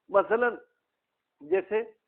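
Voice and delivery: usual speaking voice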